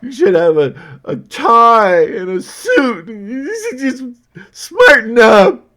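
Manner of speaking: high, loud, whiny shouting; hammed-up, high-pitched scolding yell; mock complainer. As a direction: shriek it like an outraged critic. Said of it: "some people have complained".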